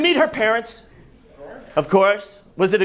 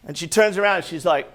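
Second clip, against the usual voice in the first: ordinary talk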